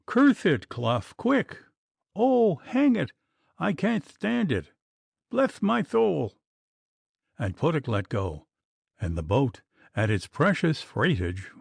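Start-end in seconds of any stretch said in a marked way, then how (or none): 0.0-6.4 high desperate cries; crying out. A narrator's reading. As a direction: wail as you lose your grip. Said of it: "Puttock was crying".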